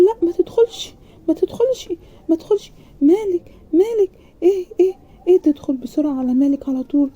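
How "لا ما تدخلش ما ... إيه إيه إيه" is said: much higher, loud flustered no; panicked refusal. A narrator's reading. Bride at the door, panicking as the groom asks to enter. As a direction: fluster and block him from coming in.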